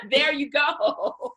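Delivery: laughing, slow and loud. Real, not acted. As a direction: laugh through it. Laughing at the tweetable remark.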